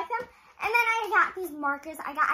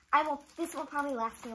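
normal talking voice

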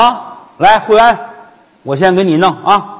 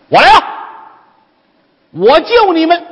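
arrive like a savior; high heroic voice for a rescue; grand hero entrance; 11 semitones higher than usual. Acted.

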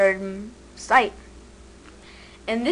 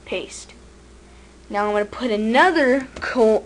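normal talking voice

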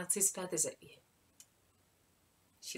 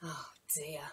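soft whispered oh dear; whispered sympathy; 5 semitones lower, 12 dB quieter than usual. Acted. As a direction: breathe it out gently. Storyteller reacts to wet shoes.

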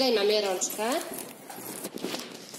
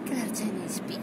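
whispering; 4 dB quieter than usual. Real, not acted. Whispering near sleeping kids.

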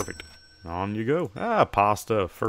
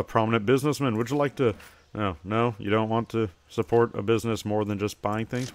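ordinary delivery

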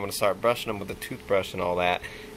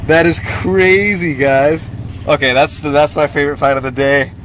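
excited; 3 semitones higher, 9 dB louder than usual. Real, not acted. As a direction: marvel loudly at the find. Thrilled over favorite find.

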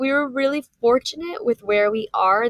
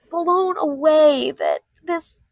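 overwhelmed; 6 semitones higher, 4 dB louder, much slower than usual. Real, not acted. Emotional story of old man.

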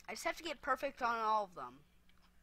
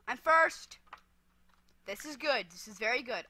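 normal talking voice

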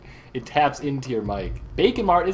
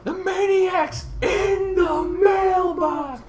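dramatic shout; much slower than usual. Acted. Shouted game quote.

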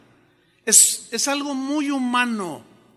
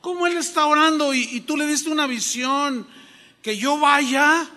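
baffled Ananías; 7 semitones higher than usual. Retold or imitated. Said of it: "Como que dijo".

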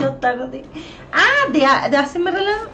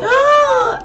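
sharp high scream, loud, retelling the scare; retold scream of fright; 11 semitones higher, 4 dB louder than usual. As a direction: let out the startled shriek again, high and loud. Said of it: "Y yo dije". (grito de susto agudo y fuerte)

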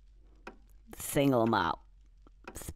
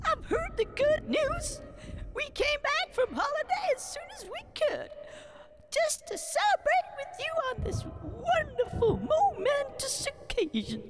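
much higher, sugary fawning voice; gushing courtier voice. Acted. Courtier flattering the new king on his return, gushing.

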